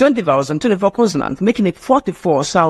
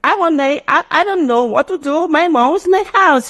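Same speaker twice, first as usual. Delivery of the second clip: put-on very high childish voice, words run together; child rattling off; 10 semitones higher than usual. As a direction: gabble it like a little kid. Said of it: "he will tell you".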